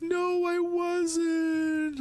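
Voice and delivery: put-on very high, slow whiny kid voice; imitating son. Dad mimicking his son who can't sleep, high and whiny. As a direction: drag it out high like a sleepy crying child. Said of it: "He's like".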